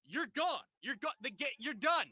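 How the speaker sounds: high mock umpire calling it; umpire ejecting. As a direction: bark out the ejection like a fed-up ump. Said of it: "He goes".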